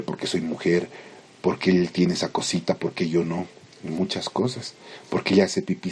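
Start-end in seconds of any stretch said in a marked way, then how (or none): none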